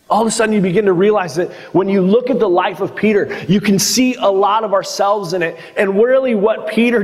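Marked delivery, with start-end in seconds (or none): none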